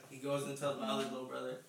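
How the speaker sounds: normal talking voice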